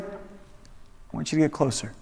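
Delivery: gentle quiet voice of God; soft answer. Retold or imitated. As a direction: drop to a near murmur to draw them in. God's quiet answer after shouting.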